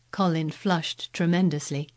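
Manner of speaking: usual speaking voice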